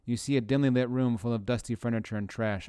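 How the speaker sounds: normal talking voice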